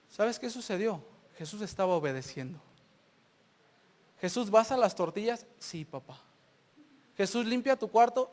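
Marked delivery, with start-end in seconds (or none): 5.6-6.2 very soft, low reply; obedient son. Acted. Jesus answering his father's chore request, soft and submissive. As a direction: answer meekly, dropping right down.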